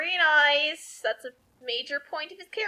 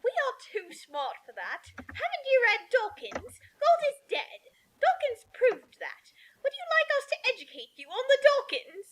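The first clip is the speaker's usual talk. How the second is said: higher, condescending know-it-all; smug atheist aunt; 7 semitones higher than usual. Acted. Aunt Petunia lecturing Hagrid, higher and condescending.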